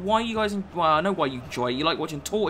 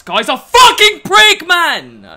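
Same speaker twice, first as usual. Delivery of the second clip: high, very loud rage; real exasperated bellow, drawn out at the end; furious yelling; 12 semitones higher, 16 dB louder, slower than usual. Real, not acted. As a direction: scream at the opponent and your players. Rages at time-wasting opponent.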